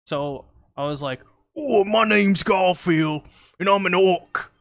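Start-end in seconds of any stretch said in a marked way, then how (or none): 1.6-4.5 higher, louder, slow Australian orc voice; Australian Garfield impression. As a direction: ham up a goofy Australian accent as Garfield the orc. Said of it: "I had an impression of Garfield".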